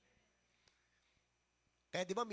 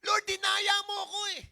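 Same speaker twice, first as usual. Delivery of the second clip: higher, louder aggrieved accusation; mock angry Jeremiah; 11 semitones higher, 11 dB louder than usual. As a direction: Jeremiah angrily accusing the Lord, imitated with rising heat. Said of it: "Si Jeremiah nga nagalit kay Lord".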